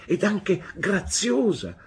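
put-on high, drawn-out childlike insistence; firm insistence. A narrator's reading. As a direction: add it slowly, high-pitched and insistent. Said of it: "ripeté con fermezza". (insistenza ferma, acuta e scandita)

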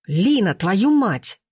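slow, drawn-out exasperated call; angry yell. A narrator's reading. Timofey up to Lina, angrily yelling. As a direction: stretch it out in acted fury. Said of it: "орал Тимофей".

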